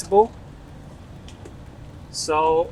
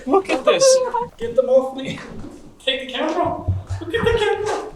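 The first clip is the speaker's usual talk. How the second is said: high, laughing panic at the flies; panicked laughing shriek; 13 semitones higher than usual. Real, not acted.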